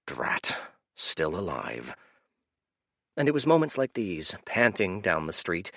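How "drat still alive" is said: breathy, low inner grumble; weary old man thinking aloud, almost silent; whispered thought. Acted. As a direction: sigh it to yourself, barely voiced.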